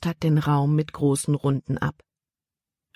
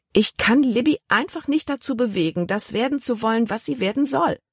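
higher, fussy aunt voice; 7 semitones higher than usual. A narrator's reading. Aunt complaining to the captain about Libby, higher fretful voice. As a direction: voice the aunt a step up in pitch, fretting. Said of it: "Das war wieder Tante Vi".

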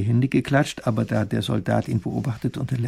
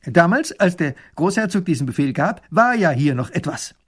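soldier voice; 5 semitones higher, 4 dB louder than usual. Acted. Soldier answers minister.

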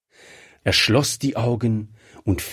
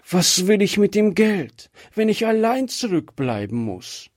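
sobbing; 8 semitones higher than usual. Acted. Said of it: "schluchzte sie".

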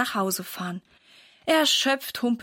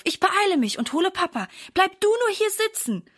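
put-on higher, bright-eyed little girl reassuring her mum; eager young girl; 9 semitones higher than usual. A narrator's reading. Daughter promises her hurt mother to fetch Papa, eager girl voice. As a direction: play the keen daughter rushing off to help.

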